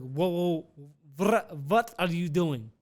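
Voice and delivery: imitating an alarmed Russian driver; put-on Russian accent, protesting. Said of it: "He's like a Russian guy".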